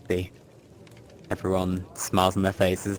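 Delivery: ordinary talk, nothing marked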